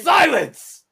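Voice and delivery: high, very loud booming command; booming frost giant. Acted. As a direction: roar the word to silence them. Dungeon master booming at player.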